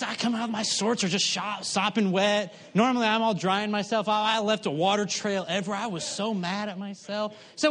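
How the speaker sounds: normal talking voice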